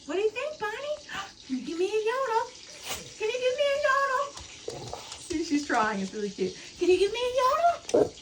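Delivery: very high coaxing pet voice; begging the dog to yodel in a very high voice; dog talk. Real, not acted. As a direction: coax the yodel out with a squeaky high voice.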